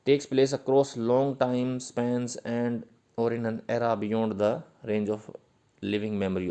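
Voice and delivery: slow dictation. Shown as a demonstration. Dictating English definition to students.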